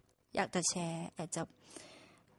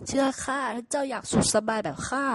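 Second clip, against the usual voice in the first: persuading donkey; 4 semitones higher, 6 dB louder than usual. Acted. Donkey urging ox.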